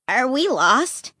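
a touch of fear. Acted. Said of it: "fear in his voice".

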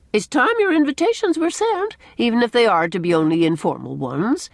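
lightly voiced fussy matron, high start; Mrs. Rachel Lynde, insistent. A narrator's reading. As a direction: nag a little, starting high and loud.

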